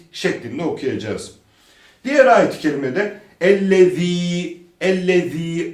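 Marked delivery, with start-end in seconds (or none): 3.4-5.7 "ellezî" with th as in "this", long i; tongue between teeth for the z of "ellezî"; lisped Z. Shown as a demonstration. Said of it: "peltek Z'dir".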